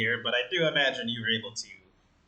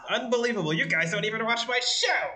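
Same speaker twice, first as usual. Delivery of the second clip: indignant outburst; 8 semitones higher than usual. Acted. Host outraged at his crew.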